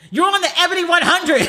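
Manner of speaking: high, emphatic mock outburst; imitated exclamation. Friend's exasperated punchline, imitated.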